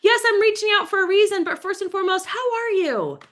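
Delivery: high, cheery catch-up call voice; gushing friendly caller. Retold or imitated. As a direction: gush like you've missed an old friend. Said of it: "if you reach out to somebody".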